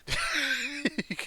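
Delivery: amused nose exhale; snorting laugh. Real, not acted. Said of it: "Did you just snort it?".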